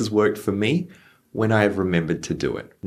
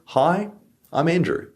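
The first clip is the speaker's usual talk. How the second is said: friendly introduction; 8 semitones higher than usual. Shown as a demonstration. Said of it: "So for example".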